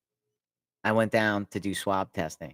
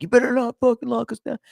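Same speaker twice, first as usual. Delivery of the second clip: high put-on yell at the nurse; mock angry officers; 13 semitones higher, 4 dB louder than usual. Angry guard yelling at the nurse about the lockdown. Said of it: "they start yelling at you".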